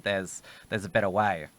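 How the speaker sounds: usual speaking voice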